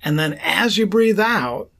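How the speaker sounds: ordinary talk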